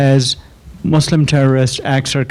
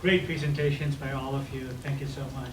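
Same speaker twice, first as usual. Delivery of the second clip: distant, far from the microphone; speaking away from the mic, much softer; 16 dB quieter than usual. Real, not acted. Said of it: "without the mic".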